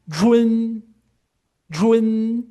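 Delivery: jun high and loud; model syllable. Shown as a demonstration. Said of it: "Ou essa próxima linha aqui". (jun alto e claro)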